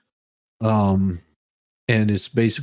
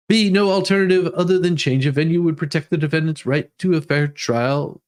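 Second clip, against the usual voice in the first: reading the motion aloud, pitched up and steady; formal legal read; 5 semitones higher than usual. Acted. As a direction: recite the filing like a court clerk. Reads court motion aloud.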